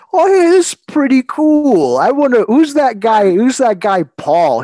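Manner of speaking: high, loud, bubbly voice; mock naive friend. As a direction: play it bright and naive. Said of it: "Courtney's like".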